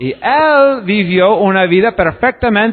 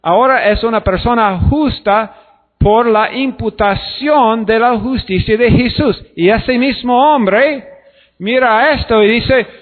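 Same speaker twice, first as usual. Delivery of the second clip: ordinary talk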